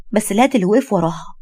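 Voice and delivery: usual speaking voice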